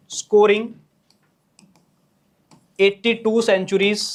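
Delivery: words drawn out one at a time while writing; slow dictation. Shown as a demonstration. Teacher dictating to students, spelling it out slowly. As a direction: read it slowly so the class can copy.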